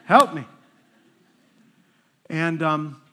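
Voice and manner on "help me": loud, high mock-desperate cry for help; inward panicked plea. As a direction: act out frantic silent praying out loud. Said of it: "on the inside, I was saying".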